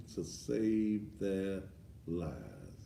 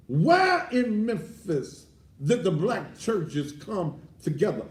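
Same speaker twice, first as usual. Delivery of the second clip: loud, rising pulpit preaching, hammering each phrase; preaching at full force; 11 semitones higher, 8 dB louder than usual. Acted. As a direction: preach it like the church is on fire.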